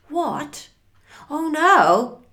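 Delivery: dismayed surprise. Acted. Frank finds a horse in the kitchen, dismayed. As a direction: react with alarm to an unwelcome shock.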